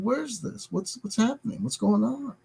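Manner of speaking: high, soft, lost questioning; bewildered alarm. Retold or imitated. Alone in his office, he wonders aloud about sudden sadness, bewildered and high. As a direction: ask it like something is creeping up on you.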